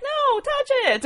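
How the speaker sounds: a lady eagerly urging them to pet her dog; eager insistence. Retold or imitated. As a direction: insist cheerfully, voice jumping up. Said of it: "she was like".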